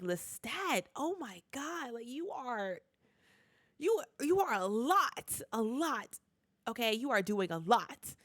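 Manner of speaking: half-laughing exasperation at a show-off; exasperated. Retold or imitated. Reacting to Lestat's antics.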